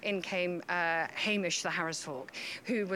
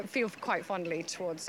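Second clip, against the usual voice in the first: ordinary talk, nothing marked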